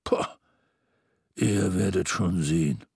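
Mister Xmas growling at Scrooge, deep and grim. A narrator's reading. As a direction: growl it low. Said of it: "knurrte Mister Xmas".

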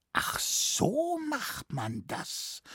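very high, soft, slow wonder; amazed realisation. Acted. Naive scholar believes forester's tall tale, softly amazed. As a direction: draw it out as the penny drops. Gullible scholar marvels at trick.

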